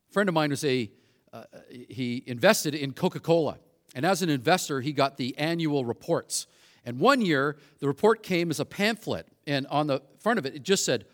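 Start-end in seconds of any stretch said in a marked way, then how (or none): none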